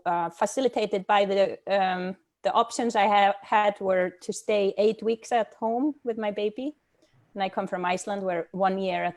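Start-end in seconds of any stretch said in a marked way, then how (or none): none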